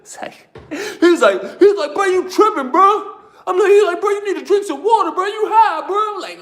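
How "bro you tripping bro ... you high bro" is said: high-pitched, insistent pleading; alarmed cellmate. Retold or imitated.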